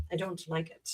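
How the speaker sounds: "I don't like it" dropped to a near-silent mutter; quiet grumbling aside; muttered under breath. Real, not acted. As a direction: repeat it to yourself, barely audible. Echoes her complaint under breath.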